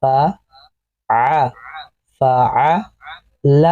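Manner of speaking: each sound stretched very slowly and joined. Shown as a demonstration. Teacher sounding out a word for pupils, very slow and stretched. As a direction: draw the syllables out slowly, joining sound to sound. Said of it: "اور اسی طرح سے آگے بڑھیں گے تو".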